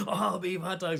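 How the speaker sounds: a milder, high vendor call; hot dog vendor call. Retold or imitated. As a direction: call it out half-pissed, half-joking. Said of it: "He's like".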